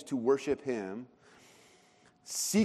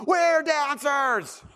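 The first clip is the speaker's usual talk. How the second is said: put-on high, loud, drawn-out kid's shout; slow, full-voiced excited yell; top-of-the-lungs yell; 12 semitones higher, 5 dB louder, much slower than usual. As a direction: imitate a boy bellowing at a concert. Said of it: "my son yells out top of his lungs".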